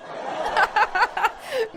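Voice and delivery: high burst of laughter; helpless giggling. Real, not acted. Host cracking up at listeners' comments, high laughter.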